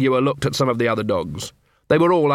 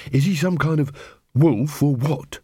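inquiring werewolf; 3 semitones higher than usual. Acted. Angua questions the poodle.

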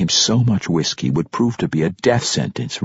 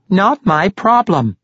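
high, slow, emphatic cut-in; emphatic interruption; 13 semitones higher, 4 dB louder, slower than usual. A narrator's reading. Barkeep cutting off the protesting halfling, emphatic. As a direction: cut in firmly, weighting each word. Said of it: "emphatically interrupted".

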